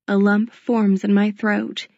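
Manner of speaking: ordinary delivery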